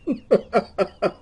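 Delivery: low chuckle in short bursts; real low, pulsing laugh. Real, not acted. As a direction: chuckle to yourself. Laughs at the card's claim.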